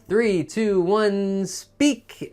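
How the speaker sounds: lightly voiced timer counting down, rising on speak; mock timer countdown. Imitating the test timer for students, rising countdown. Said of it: "There's a timer. It says".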